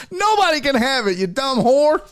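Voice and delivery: high, fast, giggling taunt; laughing mock taunt. Imagined taunt at the woman, high and laughing. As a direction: spit the taunt out through laughter. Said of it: "I'd have been like".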